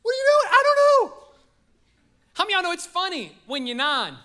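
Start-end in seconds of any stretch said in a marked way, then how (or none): 0.0-0.5 very high, loud comic scolding; hammed-up parent shout; mock parent yell. Parent yelling at kid. 0.5-1.2 very high, loud squeal of an answer; hammed-up frantic kid; panicked kid yell. Kid yelling back in panic.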